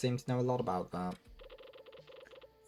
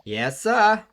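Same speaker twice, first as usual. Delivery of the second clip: eager yes sir; 13 semitones higher, 14 dB louder than usual. Acted. Eager reply to boss.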